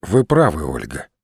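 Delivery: sober agreement, dropping on the name; serious. A narrator's reading. Rusinov admits to Olga she is right, serious. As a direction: concede it seriously. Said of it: "серьёзно сказал Русинов".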